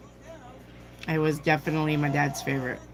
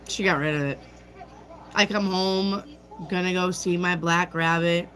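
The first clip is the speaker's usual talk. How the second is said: ordinary talk, nothing marked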